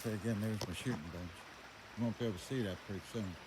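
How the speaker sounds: usual speaking voice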